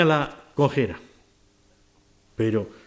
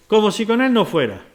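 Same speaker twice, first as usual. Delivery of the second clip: normal talking voice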